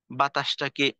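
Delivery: usual speaking voice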